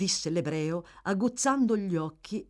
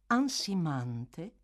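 plain reading voice

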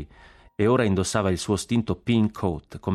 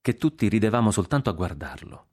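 ordinary delivery

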